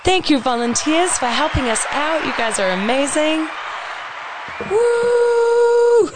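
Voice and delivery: high, drawn-out cheer of thanks; cheering shout-out. Pretend. Host thanking volunteers over applause, high cheering. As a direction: call it out high, ending in a woo.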